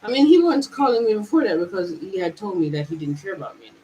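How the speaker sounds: normal talking voice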